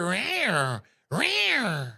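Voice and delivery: high-pitched cat-like rawr; mock a harmless little prancing tiger; soft tiger roar. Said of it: "the little prancing tiger".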